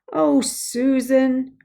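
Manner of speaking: drawn-out slow pleading protest; slow, whiny protest to a friend. Acted. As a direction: stretch it out, pleading with her. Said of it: "John protested".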